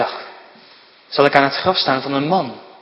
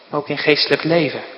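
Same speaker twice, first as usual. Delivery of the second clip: ordinary talk, nothing marked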